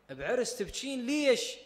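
a rising, puzzled "ليش؟"; surprised question. Retold or imitated. The Prophet asks a weeping Umm Ayman why, mildly surprised. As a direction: lift the question with mild surprise.

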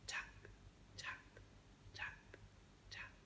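hushed footsteps, one at a time; breathed out like distant steps; whispered taps. A narrator's reading. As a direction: whisper each tap softly. Footsteps approaching in silence.

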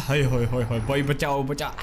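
hammed-up cry for help; mock panic. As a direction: play at being scared. Tractor front lifting, mock panic.

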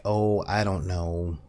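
ordinary delivery

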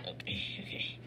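low whispered okay okay; hushed self-soothing okays. Real, not acted. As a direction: whisper it to steady yourself.

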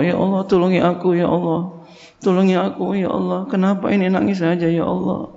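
a hint of a tearful plea; pleading prayer. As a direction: beg God gently for help. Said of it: "minta tolong sama Allah". (memohon pertolongan dengan lirih)

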